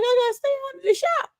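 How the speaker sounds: very high, fast squeaky voice; mock squeal. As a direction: squeak it out in a hurry. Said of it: "I'm like".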